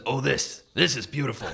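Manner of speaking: admiring character voice; awed, earnest delivery; quoting Stan. Retold or imitated. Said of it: "that picture and says".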